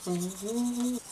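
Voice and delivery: toothbrush in mouth; talking while brushing teeth. Real, not acted. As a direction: mouth full of foam.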